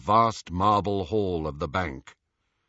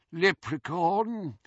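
put-on old goblin voice; 8 semitones higher, slower than usual. Old goblin dismisses coin.

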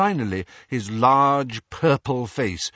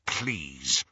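lower, softer pained please; grudging please; 8 semitones lower, 6 dB quieter than usual. A narrator's reading. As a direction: force the word out. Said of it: "wincing slightly".

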